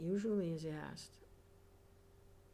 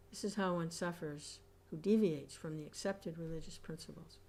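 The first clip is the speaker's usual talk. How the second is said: ordinary talk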